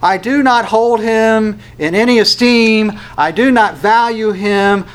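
slow, high, loud disavowal; intense denial. Acted. Peter says Jesus means nothing to him, intense denial. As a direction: hammer out each word in refusal. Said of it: "will become more intense about his denial".

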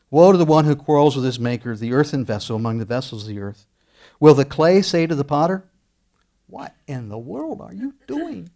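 loud, ringing scripture read; preaching scripture. Acted. Preacher reading the verse to his congregation, proclaiming loudly.

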